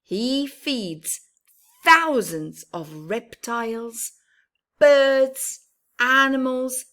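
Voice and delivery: lively storybook voice, rising on big moments; reading to children. Acted. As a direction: read it brightly to little ones.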